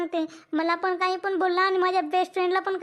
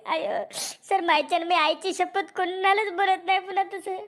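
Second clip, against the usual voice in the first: normal talking voice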